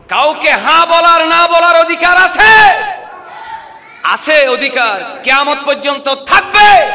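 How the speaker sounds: high, loud preaching shout; rousing shout. Real, not acted. Preacher asserts a woman's right to congregation, rousing shout. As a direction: thunder it out to the whole crowd.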